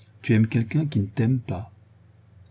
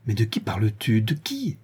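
usual speaking voice

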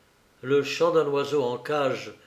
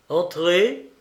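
raised voice; 5 semitones higher, 5 dB louder than usual. A narrator's reading. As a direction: call out one word, higher and louder. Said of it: "Il éleva la voix". (en élevant la voix)

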